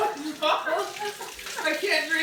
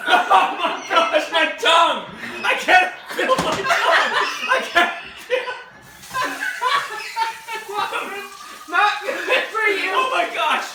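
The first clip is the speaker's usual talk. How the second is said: laughing in pain; 7 semitones higher, 3 dB louder than usual. Real, not acted. Tongue burning from hot pepper.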